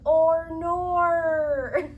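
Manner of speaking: very loud hammed-up "oh no" in an Aussie friend's manner; mock dismay. Telling viewers how she copies cool people, loud mock dismay. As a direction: throw out the borrowed exclaim big. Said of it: "And then I'm just like".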